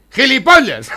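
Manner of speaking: put-on high, loud old woman yelling the insult; old lady's outburst. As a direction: blurt it out like a cranky old lady. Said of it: "ya le toca a la vieja y dice".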